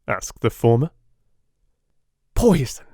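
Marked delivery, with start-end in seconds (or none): 2.3-2.9 curt. A narrator's reading. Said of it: "said Sherlock Holmes curtly".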